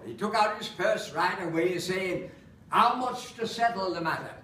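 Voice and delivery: lightly northern Lancashire lilt for a comic recitation; Lancashire accent. Acted. Performer reciting Holloway monologue to audience, Lancashire accent. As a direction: recite it in broad Lancashire English. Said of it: "so I have to do it in an accent".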